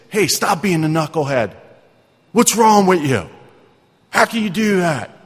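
scolding. Retold or imitated. Rebuking someone doing wrong, with a harsh rising scold. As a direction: scold a foolish friend, voice climbing. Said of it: "Does that sound like some of us".

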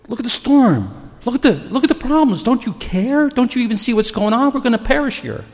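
high, loud frantic pleading; acted panic. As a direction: cry out as if about to drown. Acts out panicked disciples in storm.

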